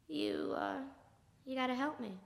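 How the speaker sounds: low, hushed request for help; grim quiet plea. Acted. Man asks his friend to help kill, grim hushed plea. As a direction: ask it low and soft, with hesitation.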